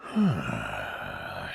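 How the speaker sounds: long, low exhale; heavy breath. Acted. Wounded man breathing really heavy, long weary exhale. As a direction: breathe out heavily, acted. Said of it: "He kinda breathes really heavy".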